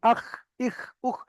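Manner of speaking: "আখ, ইখ, উখ" with a rasping throat "kh"; throaty fricative on "আখ, ইখ, উখ". Shown as a demonstration. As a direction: scrape the end of "আখ, ইখ, উখ" right at the throat. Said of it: "একদম এই গলার কাছ থেকে".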